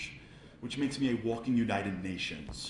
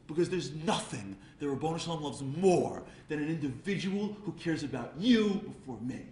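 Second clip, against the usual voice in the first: normal talking voice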